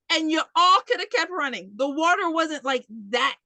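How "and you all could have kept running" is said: normal talking voice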